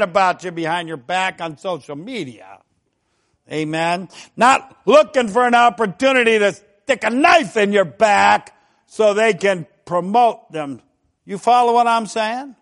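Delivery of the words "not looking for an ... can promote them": usual speaking voice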